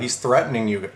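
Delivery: ordinary delivery, nothing marked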